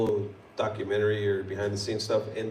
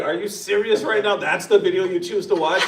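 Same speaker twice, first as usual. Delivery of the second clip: higher, half-laughing protest; mock incredulous; 7 semitones higher, 5 dB louder than usual. Son on the phone to mom about the video, mildly incredulous. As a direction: protest to mom in playful disbelief.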